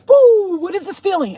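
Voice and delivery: high, loud whoop easing into wonder; acted euphoria. Addict recalling the first pill's rush, mock euphoric whoop. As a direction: whoop as if a rush just hit.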